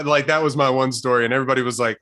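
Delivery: usual speaking voice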